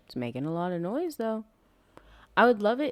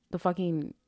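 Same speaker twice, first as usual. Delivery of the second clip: ordinary talk, nothing marked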